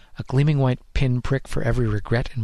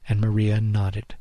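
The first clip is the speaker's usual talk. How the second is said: usual speaking voice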